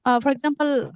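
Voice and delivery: ordinary delivery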